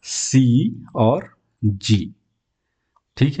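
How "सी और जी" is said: drawn-out c and g; slowly name the rule's letters; teacher slowly naming the new rule; slow announcement. Shown as a demonstration. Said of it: "तीसरा नियम है soft".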